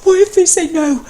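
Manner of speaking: high, loud panicked cry. Acted. Matt panicking, crying out to his husband. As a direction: gasp it out in panic. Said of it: "he gaped".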